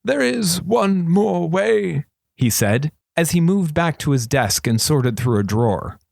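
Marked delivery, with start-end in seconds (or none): 0.0-2.1 lightly voiced old wizard, slow and higher; a hint of a sly old regent. A narrator's reading. Regent confiding to thief, lightly voiced old man.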